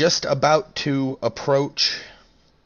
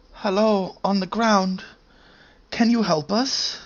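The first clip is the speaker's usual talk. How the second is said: weak voice; 7 semitones higher than usual. Acted. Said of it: "a weak voice calls out".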